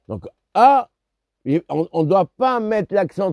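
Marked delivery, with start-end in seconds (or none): 0.5-0.9 A held alone, higher in pitch; isolated first syllable. Shown as a demonstration. Teacher isolating the first syllable, A raised and detached. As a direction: lift the A and stop after it. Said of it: "faire un arrêt sur la première syllabe".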